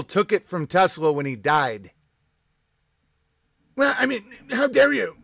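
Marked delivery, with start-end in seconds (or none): 3.8-5.2 high, indignant mock voice; mock outrage. Streamer mocking an outraged conspiracy fan, hammed-up indignant. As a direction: ham up the offended believer.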